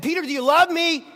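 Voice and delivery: rising, insistent repeat; pleading loud question. Acted. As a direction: push the question harder. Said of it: "The second time he said".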